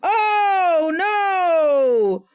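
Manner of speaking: loud, high cry of disgust; horrified cry. Real, not acted. Streamer recoils from a gross image, loud horrified cry. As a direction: wail like you just saw something awful.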